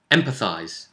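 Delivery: ordinary delivery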